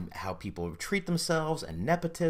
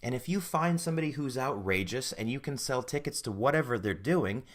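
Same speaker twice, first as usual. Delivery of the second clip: ordinary talk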